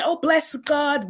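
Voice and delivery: high, slow churchy "bless God"; mock pious praise. Christians praising God when they get what they want, mock pious. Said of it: "we tend to say".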